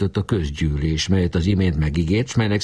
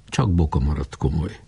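ordinary delivery, nothing marked

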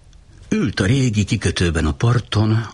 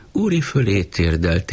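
usual speaking voice

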